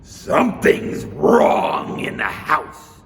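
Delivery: slow, loud, gruff bear; Papa Bear voice. Acted. Papa Bear warning his family, slow and loud. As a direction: growl out the warning slowly.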